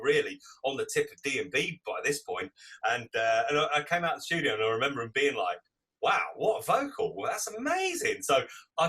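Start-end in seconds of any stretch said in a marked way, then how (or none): 6.0-8.2 higher, swooping awe; impressed amazement. Retold or imitated. DJs reacting to his new track, impressed amazement. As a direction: marvel at it, pitch leaping up.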